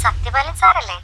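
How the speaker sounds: thin telephone caller checking who answered; phone-filtered caller. Acted. Woman caller on staged call.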